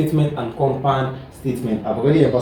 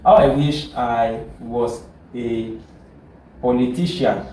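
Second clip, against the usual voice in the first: slow, deliberate example sentence; teacher dictating an example; 3 semitones lower, slower than usual. Shown as a demonstration. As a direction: read the example out slowly. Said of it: "let's change it".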